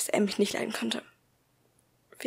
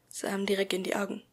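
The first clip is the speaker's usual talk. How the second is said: usual speaking voice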